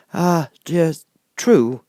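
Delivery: a nervous humbug who can't get a sentence out; stammering wizard. A narrator's reading. The Wizard stammers his plan to Dorothy, halting. Oz agrees.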